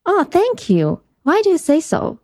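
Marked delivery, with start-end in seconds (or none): none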